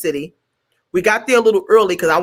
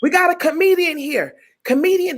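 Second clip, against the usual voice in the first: high, showy introduction; guy presenting a comedian, high excited; excited announcement; 9 semitones higher than usual. Retold or imitated. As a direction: present her with a flourish, pitched up.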